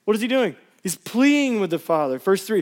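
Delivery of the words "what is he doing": mock shrug. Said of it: "He's not like".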